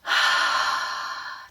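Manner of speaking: long, exaggerated audible breath; drawn-out demonstrative sigh. Shown as a demonstration. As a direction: breathe big and slow to model it. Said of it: "I do exaggerated breathing".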